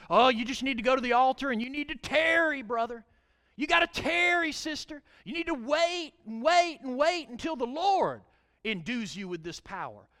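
lightly hammed-up preacher urging tarry; mock the old altar call; mocking Pentecostal exhorter. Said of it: "this little saying and say".

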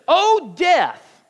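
loud, high taunting call; chiding death. Acted. Preacher taunts death to its face, chiding. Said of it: "they're almost chiding it and mocking it".

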